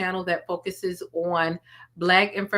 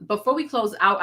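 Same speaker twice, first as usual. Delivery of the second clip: ordinary delivery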